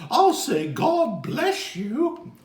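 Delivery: high and loud blessing, slowed; sing-song storybook blessing; character voice. Acted. Letter G speaking.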